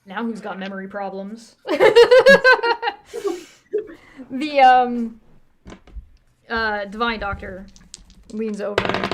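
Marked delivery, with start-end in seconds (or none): 1.6-3.4 high, loud laughing fit; loud laughter. Real, not acted. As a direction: crack up loudly at the joke. Laughs after memory problems joke.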